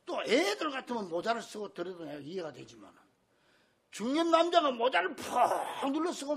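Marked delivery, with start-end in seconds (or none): none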